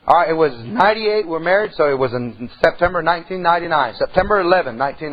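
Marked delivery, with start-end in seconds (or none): none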